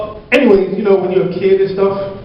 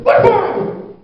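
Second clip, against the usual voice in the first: shocked mom; much slower than usual. Retold or imitated. Mom denies it.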